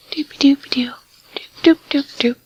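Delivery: whispered nonsense hum; idly whisper-sing to yourself; whispered doodling. Real, not acted. Killing time in the game, a whispered ditty. Humming to herself while playing.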